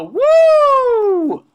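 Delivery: loud, high celebratory woo; high-pitched whoop. Acted. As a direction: whoop like a party crowd. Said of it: "I'm gonna go ahead and go".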